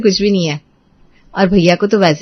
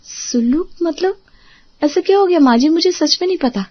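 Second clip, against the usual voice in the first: bewildered; 6 semitones higher than usual. Acted. Accused bahu confused, shaken.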